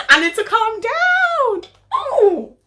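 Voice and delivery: very high, drawn-out wail; high-pitched outburst. Real, not acted. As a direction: stretch it out way up high. Over-excited, sings out at herself.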